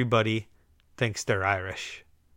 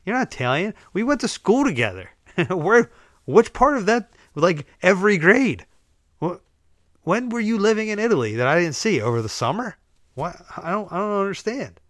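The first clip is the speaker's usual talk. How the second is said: high-pitched, louder comic disbelief; incredulous challenge; 7 semitones higher, 4 dB louder than usual. Acted. Challenging an old schoolmate who claims to be Italian, incredulous. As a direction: grill them in mock exasperation, voice jumping up.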